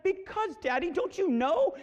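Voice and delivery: put-on high, drawn-out upset child voice; trying not to cry. Daughter explaining to dad, close to tears. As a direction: imitate a child holding back tears. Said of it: "she's trying not to cry".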